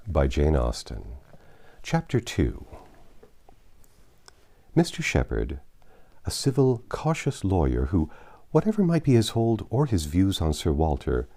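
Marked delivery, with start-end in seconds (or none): none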